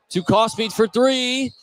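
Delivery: drawn-out, rising call as the shot goes up; anticipation. Real, not acted. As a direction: stretch it high with suspense. Calling a three-point attempt live.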